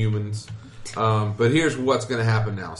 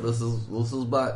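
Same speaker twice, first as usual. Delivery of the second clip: ordinary talk, nothing marked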